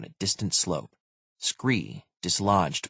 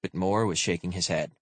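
usual speaking voice

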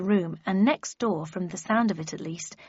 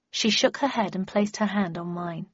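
plain reading voice

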